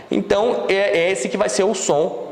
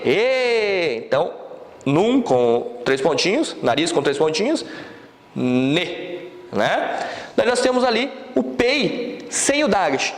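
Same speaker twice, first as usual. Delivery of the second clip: usual speaking voice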